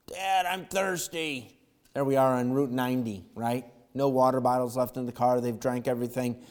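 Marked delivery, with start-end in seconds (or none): 0.1-1.6 put-on slow, higher pleading kid voice; a hint of a whining kid; whiny child. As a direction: play the thirsty child dragging it out. Child voiced in sermon story.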